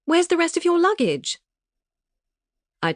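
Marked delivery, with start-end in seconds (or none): 0.1-1.4 much higher, louder, posh English dismay; aghast English friend voice; shocked prim English question; prim British friend. A narrator's reading. Arabella voiced by narrator.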